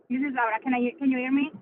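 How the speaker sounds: usual speaking voice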